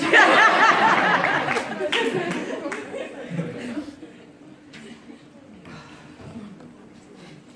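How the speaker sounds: loud, long helpless laughter; can't stop laughing; long laugh fit. Real, not acted. Laughing hard on the phone with a friend, loud helpless laughter.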